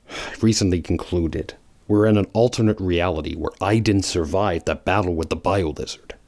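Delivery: ordinary talk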